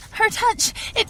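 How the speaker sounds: loud high cry of burning pain. Acted. Susie in the demon's grip, crying out in pain. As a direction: cry out as her touch sears you.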